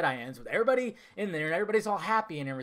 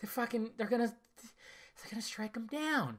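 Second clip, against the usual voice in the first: tense inner voice; 5 semitones higher, 7 dB quieter than usual. Retold or imitated. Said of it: "because I'm going".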